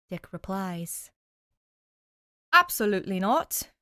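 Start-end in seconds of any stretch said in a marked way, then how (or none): 2.5-3.7 louder, firm butler voice; lightly voiced stern butler forbidding swinging. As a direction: put your foot down as the butler. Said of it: "jump at Alfred's voice".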